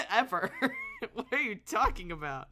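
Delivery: high, loud laughter through the words; laughing. Real, not acted. Streamer cracking up at the sibling dialogue, laughing. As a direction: laugh while saying it.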